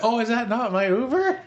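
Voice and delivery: squeaky high, playing dumb; mock innocent. Prankster plays dumb to the fooled friend, squeaky innocent. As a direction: innocently ask while trying not to laugh. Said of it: "and I'm like".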